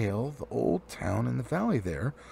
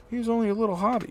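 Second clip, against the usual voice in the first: fast, higher dismissive dwarf; quick scornful jab at the little hobbit; quick mocking aside; 10 semitones higher, 4 dB louder, much faster than usual. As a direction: rattle it off, belittling the hobbit. Dwarf mocks Bilbo, fast.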